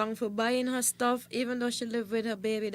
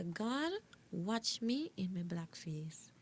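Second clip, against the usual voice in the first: soft unvoiced whisper, slowing down; 3 semitones lower, 10 dB quieter, slower than usual. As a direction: whisper it to God. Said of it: "and I'm gonna say".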